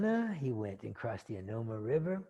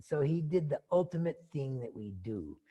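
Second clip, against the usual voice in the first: ordinary talk, nothing marked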